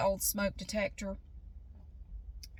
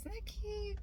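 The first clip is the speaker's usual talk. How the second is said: cutesy; 10 semitones higher, 10 dB quieter than usual. Real, not acted. Showing zebra-striped clippers.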